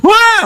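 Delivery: imitated bigger child's howl; high mock wail; tangis anak agak besar. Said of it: "yang sudah agak gede". (tiruan raungan tangis anak, tinggi)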